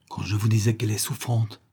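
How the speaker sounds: plain reading voice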